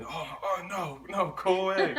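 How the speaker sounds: high-pitched mock alarm; alarmed refusal. Gas station staff shooing off a drunk, mock-alarmed.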